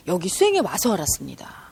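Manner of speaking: hushed confession to the audience; a soft, secret admission; whispered aside. Real, not acted. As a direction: confide it in a whisper. Confiding aside to listeners.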